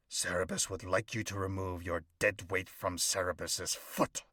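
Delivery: ordinary delivery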